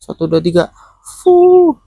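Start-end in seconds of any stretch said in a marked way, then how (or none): 1.2-1.8 one hard puff of breath; blowing. Pretend. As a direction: blow out hard like on a candle. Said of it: "Yo, bantu tiup".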